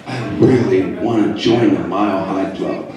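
low, sultry imitation of his ex-wife; sexy voice. Ex-wife on hold with the travel agent, low mock-sexy voice. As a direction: purr it low like a mock seduction. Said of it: "she says in a sexy voice".

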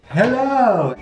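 high, bright hello; cheery greeting. Acted.

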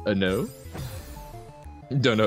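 usual speaking voice

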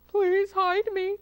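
high, loud, drawn-out plea; frightened cry. A narrator's reading. Fox begs Hamlet to hide him, crying out. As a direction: cry out slowly for help. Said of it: "cried the fox".

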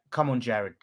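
ordinary talk, nothing marked

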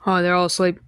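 normal talking voice

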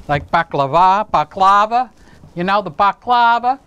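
"baklava" said as Greeks say it, stress on the first syllable; "baklava" with open "ah" vowels throughout, BAH-klah-vah; Greek-style pronunciation. Retold or imitated. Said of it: "I love it when they say it like that".

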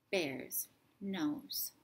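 ordinary delivery, nothing marked

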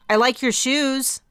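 high, slow nervous blurt. A narrator's reading. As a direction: blurt it out awkwardly. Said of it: "I blurted".

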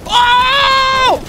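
huge stunned shout; shocked scream. Real, not acted. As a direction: scream in disbelief.

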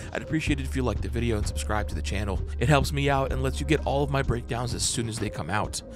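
normal talking voice

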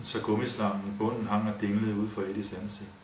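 usual speaking voice

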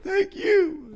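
very high, thin falsetto; comic squeaky reply; mock-shouted thanks. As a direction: squeal thanks right back. Own reply in shouted exchange.

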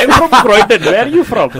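put-on loud, high, laughing British Indian accent. Host answering the British challenge, loud British Indian accent. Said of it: "I didn't say a British Indian".

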